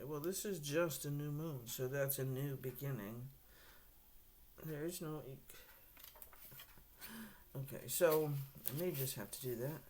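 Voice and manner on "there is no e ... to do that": normal talking voice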